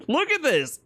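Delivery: high, hyped exclamation; excited. Real, not acted. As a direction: point it out in disbelief. Reacting to grab, hyped.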